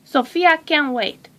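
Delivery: ordinary delivery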